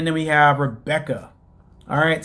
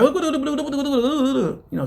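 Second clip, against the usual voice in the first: high, slow gibberish imitation of Nigerian speech; laughing, mocking babble of Nigerian talk; 10 semitones higher than usual. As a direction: lightly parody a foreign tongue with a laugh. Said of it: "talking in his Nigerian, um, tongue".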